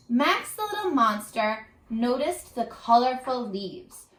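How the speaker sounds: usual speaking voice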